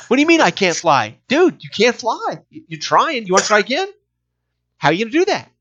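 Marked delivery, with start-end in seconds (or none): none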